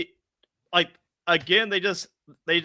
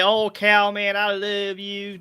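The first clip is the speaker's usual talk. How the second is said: slow, drawn-out country drawl; thick country accent; slower than usual. Retold or imitated. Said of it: "country ass accent".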